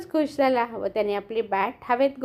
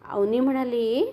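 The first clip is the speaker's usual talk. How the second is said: ordinary delivery, nothing marked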